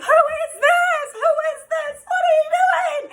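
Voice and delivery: high, loud imitation of shrieking; mock screaming. Imitating the ex confronting him, mock screaming. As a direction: screech it at him like the furious ex. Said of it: "screaming at him".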